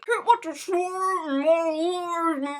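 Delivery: slow, high, loud garble; muffled mock gorging; mouth crammed full. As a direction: moan through a mouthful of food. Friend talking with cheeks stuffed.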